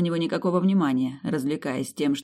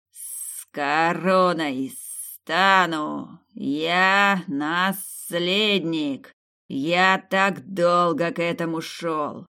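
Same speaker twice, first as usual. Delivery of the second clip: very slow, drawn-out raving; slow obsessed rant; ranting old serpent; much slower than usual. Acted. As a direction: stretch each word like a hissing serpent king. Mad grandfather claims crown.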